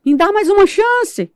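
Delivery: very high, slow, wailing cry; desperate scream. Retold or imitated. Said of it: "E eu comecei a gritar". (grito agudo e desesperado)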